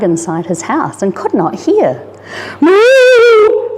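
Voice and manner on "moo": a long, much higher, louder cry for help; a cow calling out, swept away; desperate moo. Acted. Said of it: "called Matilda desperately".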